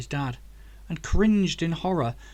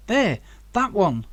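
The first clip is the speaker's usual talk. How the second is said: louder, higher and slow, pointing it out; excited pointing; 6 semitones higher, 5 dB louder than usual. A narrator's reading. Zach spots the getaway car, slow excited call. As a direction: call out the car you just spotted.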